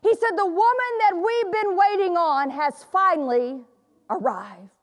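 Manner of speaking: calling his wife from the foot of the stairs; mock yell up stairs. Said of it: "he yelled up the stairs".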